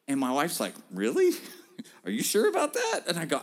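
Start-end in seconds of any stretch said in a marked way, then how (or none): 0.9-3.0 amused disbelieving question, rising high; laughing doubt. Retold or imitated. Wife doubting his plan.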